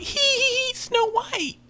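very high, tiny squeaky voice; tee-hee it like a tiny sprite; giggly little fairy. Retold or imitated. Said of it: "they walk around like".

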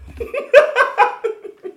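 high, loud burst of laughter. Real, not acted. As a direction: crack up laughing. Host reacts to punchline.

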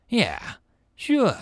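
ordinary delivery

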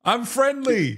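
high-pitched talking-to-a-cat voice; friendly coaxing. Real, not acted. Guest calling to a cat, high coaxing voice.

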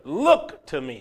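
usual speaking voice

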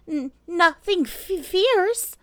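very high, slow, timid squeak; anxious stammer. Acted. Piglet asks Pooh about danger, high and stammering anxiously. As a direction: stammer fearfully toward the dreaded word.

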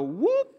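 quick high whoop sound effect; imitated whoop. Mimics anxiety narrowing your view.

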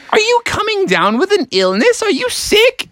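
high-pitched worried-mom questioning; concerned mother. Acted. Mother questioning sniffly son in story.

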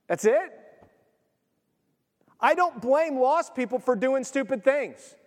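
ordinary talk, nothing marked

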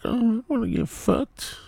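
put-on high-pitched whiny teen; mock teen voice. Dad mimicking a teen kid, high and mocking. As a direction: squeak it like a horny teenager. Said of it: "you're gonna be like".

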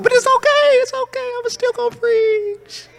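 very high, thin-held pitch; voice gone high. Real, not acted. Preacher reassuring himself he'll still preach, voice gone high. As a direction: let the voice shoot up high, stretching the last words. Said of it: "my voice went high like that".

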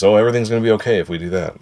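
quick, breezy inner thought; fast inner voice. Retold or imitated. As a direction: rattle off the happy thought. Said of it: "and he thinks".

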